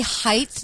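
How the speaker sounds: height with a TH sound on the end; mispronounced height. Retold or imitated. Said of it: "like there's an H at the end".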